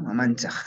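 Mamanchaj said "ma-MAN-tsaj"; Huaylas variant. Shown as a demonstration. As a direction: end Mamanchaj in tsa plus a back-of-throat fricative. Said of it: "lo pronunciamos como Mamanchaj".

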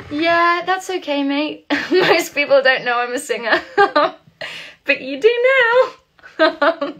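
laughing. Real, not acted. Laughing reply to a fan.

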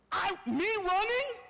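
high, slow, incredulous; indignant disbelief. Acted. Reluctant servant at being made to run, incredulous. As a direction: protest it as absurd, pitched high.

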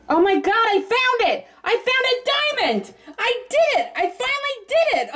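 shrieking with real delight at the find; thrilled. Real, not acted. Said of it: "I'm so excited!".

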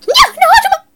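very high, loud string of noes; panicked shout. Real, not acted. Gamer about to be eaten, shouting in panic. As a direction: cry out as it goes wrong.

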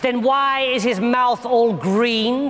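high, slow accusing demand. Acted. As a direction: demand an answer, drawing it out high. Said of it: "demanded Salome".

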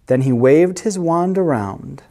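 plain reading voice

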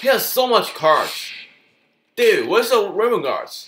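surprised outcry. Real, not acted. Surprised at opponent's army.

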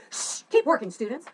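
impatient, rude teacher scolding. Shown as a demonstration. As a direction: snap at the class to get back to work. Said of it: "didn't want the teacher to come off like".

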